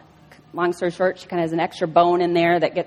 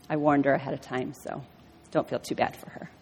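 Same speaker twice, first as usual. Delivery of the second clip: hushed aside; 7 semitones lower, 10 dB quieter than usual. Real, not acted. Aside to congregation.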